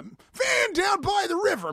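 high, drawn-out shouted character voice; Matt Foley. Acted. Recalling a sketch character for the hosts, Matt Foley shout. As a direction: bellow it high, stretching the words out. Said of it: "Matt Foley?".

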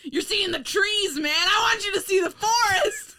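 loud, high, laughing exasperated shout. Real, not acted. Shouting at cohost Kevin to see the forest, laughing and exasperated. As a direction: yell at him through laughter to see the big picture.

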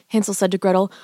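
ordinary delivery

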